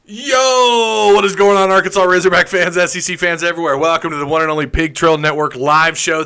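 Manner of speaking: loud, high hype host intro; show intro. Acted. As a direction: welcome the fans like an announcer. Rehearsed show opening.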